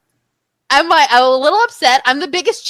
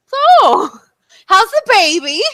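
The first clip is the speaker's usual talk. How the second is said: higher, put-on chatty voice; awkward small talk; 6 semitones higher, slower than usual. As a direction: fill the silence awkwardly. Awkward small talk to the other guy.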